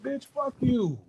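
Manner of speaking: a hint of an angry comeback; mock snap. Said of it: "I'm like".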